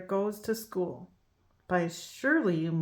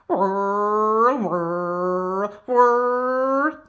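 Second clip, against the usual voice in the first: robot whir; 4 dB louder, much slower than usual. Acted. Robot marching sound.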